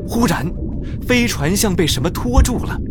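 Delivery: ordinary delivery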